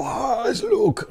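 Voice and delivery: high, slow, stretched fan gasp of joy; hammed-up emotional squeal; mock tearful excitement. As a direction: play a fan overcome at seeing Luke. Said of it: "todo el mundo llorando".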